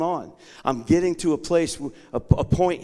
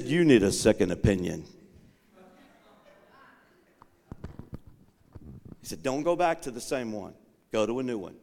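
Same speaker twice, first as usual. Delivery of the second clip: lower, steady voice of God; calm voice of the Lord; 6 semitones lower than usual. Retold or imitated. Preacher voicing the Lord telling him to seek help.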